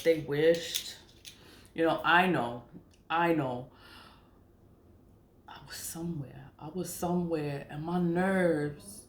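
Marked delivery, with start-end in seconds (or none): 5.5-6.5 sudden hushed whisper; whispered aside. Real, not acted. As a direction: drop to a breathy whisper as if telling a secret. Dropping to a whisper mid-story.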